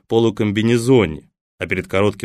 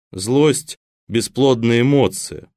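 ordinary delivery, nothing marked

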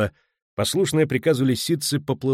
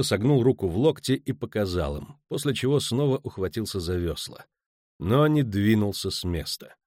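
plain reading voice

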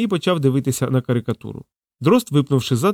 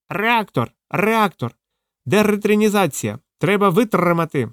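high-pitched croaky parrot voice; hoarse parrot cry; 7 semitones higher, slower than usual. A narrator's reading. Parrot cries out at the people, hoarsely. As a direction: squawk it like a parrot. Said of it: "хрипко викрикнув".

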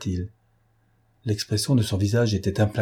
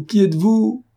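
much higher and louder, rising; the repeat breaks upward in alarm; stammered cry; 11 semitones higher, 5 dB louder than usual. A narrator's reading. Beaupré repeating his question to Cernine in alarm. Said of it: "balbutia Beaupré".

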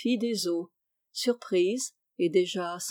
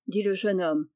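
ordinary delivery

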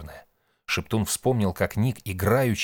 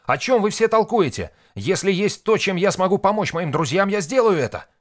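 high, loud, insistent demand; explosive outburst; 12 semitones higher, 6 dB louder than usual. A narrator's reading. Nik demanding to help his friends, exploding. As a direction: burst out, keeping it high and loud to the end. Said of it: "взорвался Ник".

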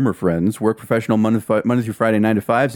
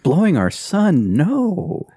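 higher, slow hammed-up denial; mock shocked denial; 9 semitones higher, much slower than usual. Friend echoing the actress's denial as a punchline, mock shocked. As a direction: echo the line with fake innocence. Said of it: "friend echoes the quote".

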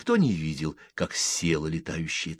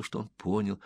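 ordinary delivery, nothing marked

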